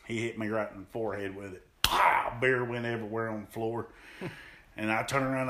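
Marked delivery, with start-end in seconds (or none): 1.8-2.4 impact sound. Retold or imitated. Beer can hitting forehead.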